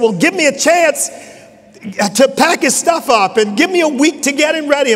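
声を上げ、泣きそうに懇願する里親の母; 涙ながらに頼み込むように; tearful plea. Retold or imitated. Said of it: "she just cried and, and wept".

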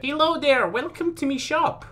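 high-pitched mock shopkeeper greeting; playful high shopkeeper voice; put-on shopkeeper. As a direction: greet customers in a silly high voice.

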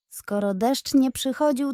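plain reading voice

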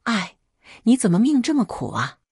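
sorrowful lament. Acted. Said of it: "我妈一脸悲哀道".

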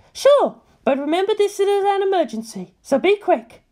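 sudden sunny turn, still bossy; cheerful Misato. A narrator's reading. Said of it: "she cheered up and said".

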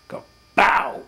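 a high, loud "bow" like a blast going off; mock boom. Showing viewers how the sphere fired, a mock boom. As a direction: burst out with the sound of the shot. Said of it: "It sounds like a thunderbolt".

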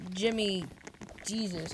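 usual speaking voice

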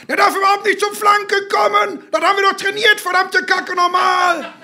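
high-pitched furious touchline rant; sustained high shout; angry shouting. A narrator's reading. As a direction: yell it at your failing son on the pitch. Angry father shouting at match.

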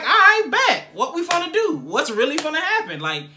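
worked-up challenge, retold with energy; retold fighting challenge. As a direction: act out squaring up to a crowd. Retold showdown before group fight.

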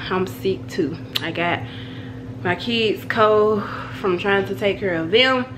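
usual speaking voice

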